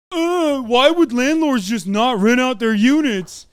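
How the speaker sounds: mocking dumb voice. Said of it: "dumb motherfuckers on Twitter constantly say".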